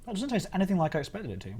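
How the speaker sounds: talking with mouth full; words round a bite of biscuit. Real, not acted. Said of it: "Sorry for the crumbs, sorry for the noises".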